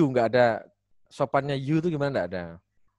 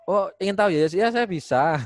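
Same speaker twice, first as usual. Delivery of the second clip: bright, high playful teacher voice, a little louder; teasing teacher; 7 semitones higher, 3 dB louder than usual. Pretend. Teacher playfully stalling a student who asks for an example, mock coy.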